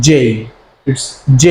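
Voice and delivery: "J" with a full ey glide; correct J. Shown as a demonstration. Teacher giving the correct pronunciation to learners, gliding "J". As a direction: say "J" drawn out, ending towards i. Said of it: "সঠিক উচ্চারণটা হবে".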